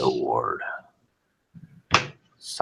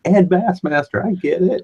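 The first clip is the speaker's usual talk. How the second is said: high-pitched mock quote; commenter's voice. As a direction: imitate an online commenter, way up high. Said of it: "somebody actually made the comment".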